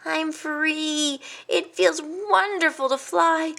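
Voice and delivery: high, dreamy joy of flying; blissful delight. A narrator's reading. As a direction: revel in being free.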